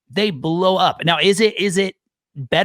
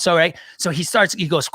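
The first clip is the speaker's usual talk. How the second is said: ordinary talk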